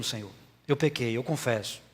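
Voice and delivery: confissão em voz mais baixa e grave; confissão contrita. Acted. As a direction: confesse baixinho, como quem fala com Deus. Voicing a penitent's confession to God. (confissão humilde, em voz baixa)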